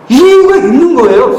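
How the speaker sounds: 이유가 loud and high, rest falling; stress on 이유가. Real, not acted. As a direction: punch 이유가 to drive the point home. Preacher stresses the point.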